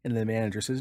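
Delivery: ordinary delivery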